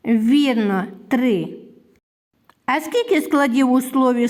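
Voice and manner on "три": usual speaking voice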